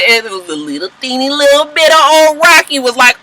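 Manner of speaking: usual speaking voice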